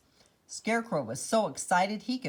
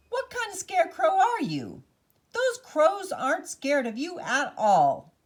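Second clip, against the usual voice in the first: scolding farmer; 10 semitones higher, 6 dB louder than usual. Acted. Farmer Ned scolding the scarecrow.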